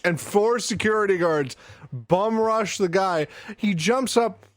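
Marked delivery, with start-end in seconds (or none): none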